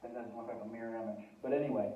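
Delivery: ordinary talk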